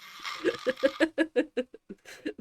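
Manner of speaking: a high, bubbling burst of laughter. Real, not acted. Laughing at her own sinking-ship joke, high and bubbly. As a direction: laugh it off lightly.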